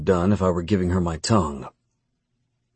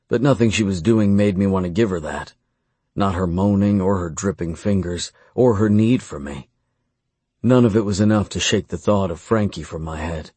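usual speaking voice

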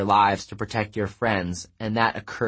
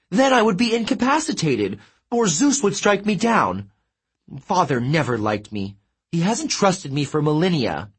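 much higher, preening god; vain Apollo boasting; 7 semitones higher than usual. A narrator's reading. Apollo boasts to his sister, much higher and preening. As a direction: show off a perfect tan with glee.

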